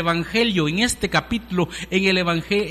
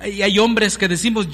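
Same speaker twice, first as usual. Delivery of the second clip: fervent preaching; 2 semitones higher, 4 dB louder than usual. Acted. Preacher rises to full force.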